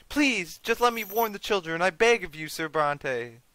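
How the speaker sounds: high, desperate begging voice; tearful pleading mother. Acted. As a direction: beg in a high voice as a crying mother.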